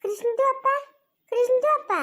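high-pitched singsong call, rising at the end. Acted. Neighbour calls out to him. (ಎತ್ತರದ ದನಿಯಲ್ಲಿ ಕೂಗಿ ಕರೆಯುವುದು)

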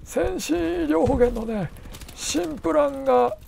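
slow, high, drawn-out mimic voice; Hiraizumi Sei impression. Telling mother about an insurance plan, slow high impression. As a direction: stretch it out in a high put-on voice. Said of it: "ちょっと真似してたんですよね".

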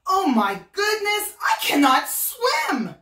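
monkey shouting in fright; frightened monkey yelling as he sinks; panicked shout. Acted. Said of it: "shouted Monkey".

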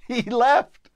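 amused, tossing the line off through a laugh. Real, not acted. Storyteller laughing to the listeners at the boy leaving. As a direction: laugh out the words, high and loud.

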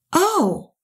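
high, sudden "oh"; dawning realization. Retold or imitated. She finally understands the attendant's hint, surprised high "oh". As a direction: let the penny drop.

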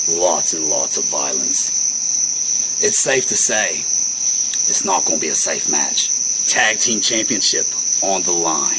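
slow, low, dramatic fight-night promo read; wrestling promo. Acted. Two hosts hyping a tag team match, low dramatic promo. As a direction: build up the match like a trailer voiceover.

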